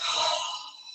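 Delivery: big audible sigh out the mouth; dramatic sigh. Shown as a demonstration. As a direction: let out a long breathy sigh. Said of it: "big sigh out the mouth".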